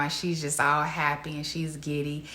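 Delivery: usual speaking voice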